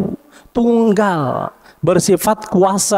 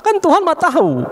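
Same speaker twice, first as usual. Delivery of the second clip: mocking exclamation; 12 semitones higher than usual. Mocking aside.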